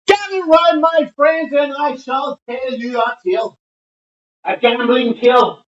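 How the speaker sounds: high, grand storyteller call; theatrical storyteller. Pretend. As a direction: announce it like a fairground yarn-spinner. Theatrical storyteller opening, raised pitch.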